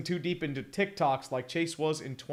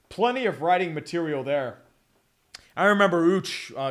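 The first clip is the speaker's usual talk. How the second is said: ordinary delivery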